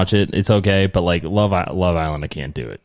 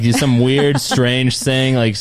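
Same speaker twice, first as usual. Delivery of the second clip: normal talking voice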